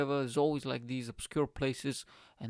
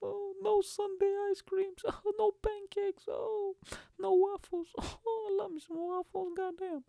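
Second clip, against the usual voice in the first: much higher, laughing mock lament; mock wail. Mourning lost ice cream and waffles, high mock wail. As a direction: wail comically for each lost treat.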